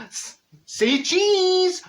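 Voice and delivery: high, slow sing-song say cheese; sing-song camera call; photographer's call. Retold or imitated.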